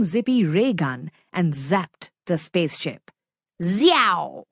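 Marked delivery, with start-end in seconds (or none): 3.6-4.5 sharp sci-fi blaster noise; ray gun zap sound. A narrator's reading.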